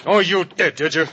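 high, loud and disbelieving; jealous accusation. Acted. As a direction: throw it back at him accusingly. Jealous husband accuses friend.

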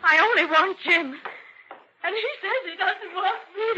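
distraught wife laughing through the confession; overwrought confession. Acted. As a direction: blurt it out and crack into a laugh.

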